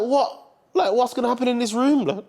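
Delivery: puzzled and suspicious; baffled friend. Retold or imitated. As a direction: friend startled by the officer's secret request. Friend baffled by officer's request.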